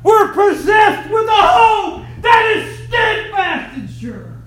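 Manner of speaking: high, loud shouted preaching; preaching at full force. Real, not acted. Preacher shouting to the congregation about Nazareth.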